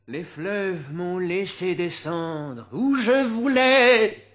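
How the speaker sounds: slow, loud and high, let the line soar; swelling declamation. Acted. Poet reciting to a hall, slow soaring loud declamation. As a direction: proclaim the freedom of the rivers broadly.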